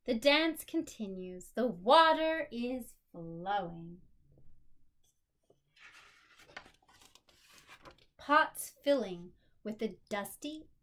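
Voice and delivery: expressive picture-book read-aloud; story narration. A narrator's reading. Reading her picture book aloud to a listening child, expressive storytelling.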